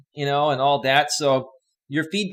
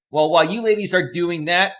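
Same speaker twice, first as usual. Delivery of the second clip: ordinary delivery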